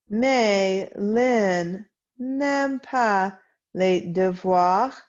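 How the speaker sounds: slow, clear French reading for students to follow; slow read-along. Shown as a demonstration. Teacher reading a French text aloud for her class, slow and clear. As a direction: read each word slowly so the class can read along.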